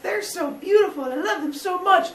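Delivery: high gushing mother voice; doting mother. Retold or imitated. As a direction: gush like a doting new mom. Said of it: "is overwhelmed with love".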